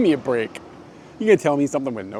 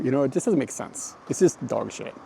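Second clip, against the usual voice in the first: ordinary delivery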